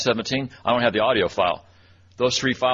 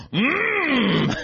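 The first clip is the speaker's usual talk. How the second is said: much higher, hammed-up mm of delight; exaggerated yummy hum. Sub topping the kids' cookie noise, high playful hum. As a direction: squeal the yum back at them. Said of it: "and I was like".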